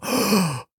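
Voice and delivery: big acted gasp of delight; sharp, thrilled intake of breath; awed gasp. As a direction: gasp like an amazed child. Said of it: "made my inner child go".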